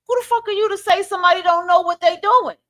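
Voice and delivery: high-pitched, scolding challenge; indignant rant. Real, not acted. Rebuking those who excuse knowing wrongdoers, indignant rant.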